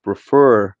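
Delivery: "prefer" with a loud second syllable; stress on final syllable. Shown as a demonstration. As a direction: hit the end of "prefer" hard. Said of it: "caso esta C V C seja sílaba tônica".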